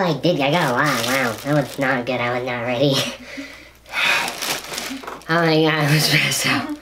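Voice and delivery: very low, booming gas-deep voice. Real, not acted. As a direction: speak from way down after a gas breath. Deep voice after inhaling gas.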